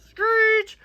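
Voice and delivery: high squealing tire screech. Acted. Mimicking a car skidding to a stop, squealing.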